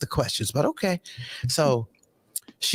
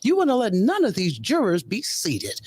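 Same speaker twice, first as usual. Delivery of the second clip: scolding judge; 7 semitones higher than usual. Retold or imitated. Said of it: "You know".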